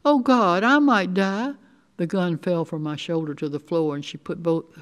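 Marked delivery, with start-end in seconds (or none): 0.0-1.5 higher, louder cry of dread; distressed outcry; panicked realization. A narrator's reading. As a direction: let the fear burst out, pitch raised. Girl panics about dying.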